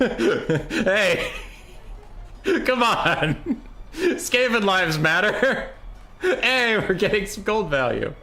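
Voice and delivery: high-pitched outburst broken by real laughter; laughing protest. Real, not acted. Streamer objecting to the game's gold values, high laughing indignation. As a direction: protest through helpless laughs.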